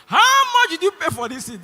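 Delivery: high, loud confrontation; angry demand. Retold or imitated. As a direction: confront a seated passenger loudly. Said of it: "Got angry".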